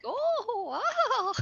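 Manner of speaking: very high, drawn-out wow; amazed spectator. Acted. Clapping for the newly transformed wolf, high-pitched awe. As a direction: gush in wonder, pitch swooping up. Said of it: "I'll be like".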